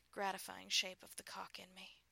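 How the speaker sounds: ordinary delivery, nothing marked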